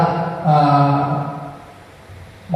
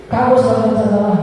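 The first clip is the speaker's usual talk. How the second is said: very high, rushed accusation, falling at the end; accusing, in one fast high-pitched run; rapid punisher's scolding; 9 semitones higher than usual. Shown as a demonstration. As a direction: fire it off like a punishing teacher. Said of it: "Saking cepatnya tidak terdengar".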